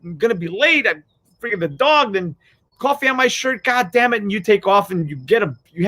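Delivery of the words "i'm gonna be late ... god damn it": much higher, sputtering mock fury; a hammed-up annoyed driver cursing; pissed-off rant. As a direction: vent at the spilled coffee and the dog. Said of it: "you get pissed off, like".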